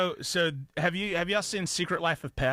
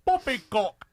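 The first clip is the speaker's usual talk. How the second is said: higher, attempted copy of her British accent; imitated British accent; 7 semitones higher than usual. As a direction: try her tucked-lip British voice. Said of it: "I can't do it like that".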